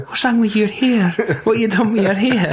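high, put-on questioning; teasing high-pitched mimicry; mock concerned character. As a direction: imitate a film character fussing in a high voice. Mimicking characters reacting to haircut.

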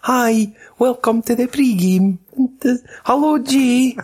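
high, slow American voice; American accent. Acted. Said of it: "saying this next thing in American accent".

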